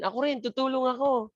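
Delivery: high-pitched, eager me-too voice; Francis volunteering. Acted. Teacher mimics a second student joining in, high mock-eager voice. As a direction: chime in high as another volunteer. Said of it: "Si Francis, magtataas din ng kamay".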